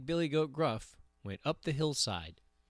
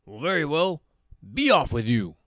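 lightly voiced troll, growing louder; 3 semitones higher, 5 dB louder, faster than usual. A narrator's reading. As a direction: dismiss them as the troll, loud at the end. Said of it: "said the troll".

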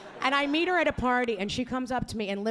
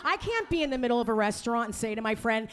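usual speaking voice

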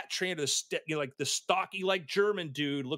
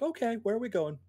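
calm cat voice; 8 semitones higher than usual. Retold or imitated. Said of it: "the cat was just like".

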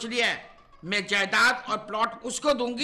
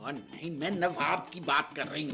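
hushed whisper; 7 semitones lower, 6 dB quieter than usual. Acted. Mother hushing about guest.

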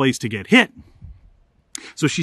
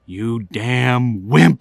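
lower, slow held-back scold; angry scold; 6 semitones lower, slower than usual. Retold or imitated. Dad scolding the horse in a low slow scold. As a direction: point a finger and spit out wimp. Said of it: "he goes".

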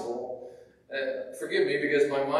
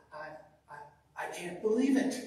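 stammering; 5 dB quieter, faster than usual. Retold or imitated. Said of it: "sort of stammers".

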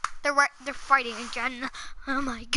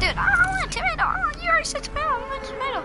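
a tiny cartoon voice, much higher and fast; squeaky silly voice; 4 dB louder, much faster than usual. Gamer goofing off to teammate, high cartoon voice. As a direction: pitch it way up like a goofy cartoon kid begging.

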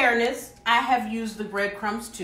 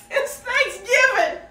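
much higher and slow, savoring "it's Thanksgiving"; giddy delight; 12 semitones higher, 4 dB louder, slower than usual. Real, not acted. Cook tasting yams, much higher, slow delight. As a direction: stretch it out in pure joy.

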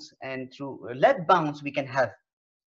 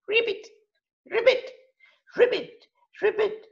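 very high ribbit ribbit; frog croak. Shown as a demonstration. Teacher showing animal sounds, high frog ribbit. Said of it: "the animal sounds like".

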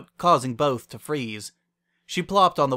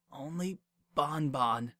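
soft, slow, hushed voice; whispered to herself; 3 semitones higher, 5 dB quieter, slower than usual. A narrator's reading. Lyra alone as Wendy leaves, whispering to herself. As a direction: trail it out quietly, almost whispering. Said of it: "Lyra whispered to herself".

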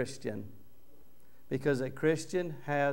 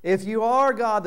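loud, higher jeering taunt; thief mocking; 8 semitones higher, 8 dB louder than usual. Thief on the cross mocking Jesus, loud jeer. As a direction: sneer it up at him. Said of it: "the thief mocked".

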